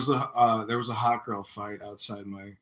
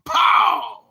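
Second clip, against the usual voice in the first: explosive high "pow"; shouted punch noise; 14 semitones higher, 14 dB louder than usual. Retold or imitated. Man retelling how he got punched in the face, shouted impact. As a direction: shout it like a sudden blow landing. Imitates the sudden punch in the story.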